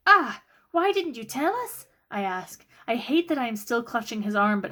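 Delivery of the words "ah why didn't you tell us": hurt indignation. Acted. Sister confronts brother.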